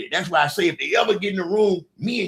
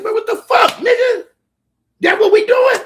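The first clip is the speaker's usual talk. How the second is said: much higher, louder mock outrage; retold angry yell; 10 dB louder than usual. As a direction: yell it like you just found out. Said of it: "I said".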